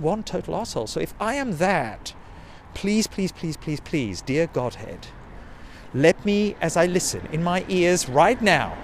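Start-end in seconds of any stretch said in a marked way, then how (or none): none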